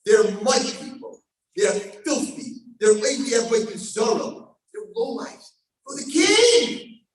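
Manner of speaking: loud, slow accusation; accusing plea. Acted. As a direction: denounce them with rising force. Angels accuse the nation.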